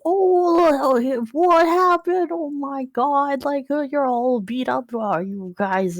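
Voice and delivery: high, slow, fretting uncle voice; Uncle Henry. Acted. Uncle meeting the beat-up party, high drawn-out alarm. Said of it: "So obviously Uncle Henry".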